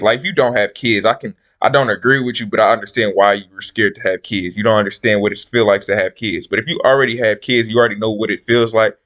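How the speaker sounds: ordinary talk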